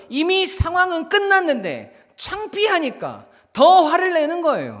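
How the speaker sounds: loud, high, emphatic sermon delivery; full-force preaching. Real, not acted. Preacher explaining the bully's pride to congregation, impassioned. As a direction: drive each phrase home at the top of the voice.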